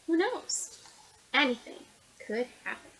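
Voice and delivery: plain reading voice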